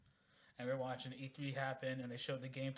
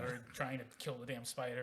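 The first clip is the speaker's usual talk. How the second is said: normal talking voice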